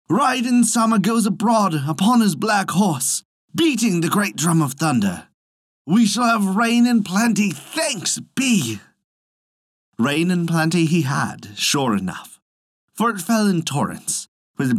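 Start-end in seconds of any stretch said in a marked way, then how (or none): 0.1-8.8 high, exclaiming peasant voice; excited cry. A narrator's reading. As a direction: proclaim the coming rain, pitched up. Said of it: "cried the peasant".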